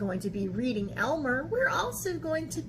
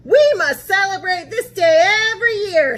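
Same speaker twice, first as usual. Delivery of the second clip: much louder and higher, joyful proclamation; loud happy call to celebrate; excited elephant; 7 semitones higher, 12 dB louder, faster than usual. A narrator's reading. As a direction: announce it with joy. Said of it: "said another".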